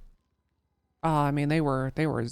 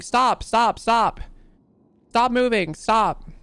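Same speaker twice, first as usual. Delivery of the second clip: raised voice, imitating shouted police commands; retold scream; 5 semitones higher, 5 dB louder, slower than usual. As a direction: re-enact yelling at suspects, louder and higher but controlled. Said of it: "I'm screaming at them".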